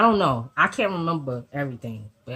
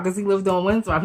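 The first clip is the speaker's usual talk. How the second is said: usual speaking voice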